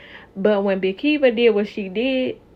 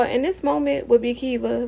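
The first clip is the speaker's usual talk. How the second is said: normal talking voice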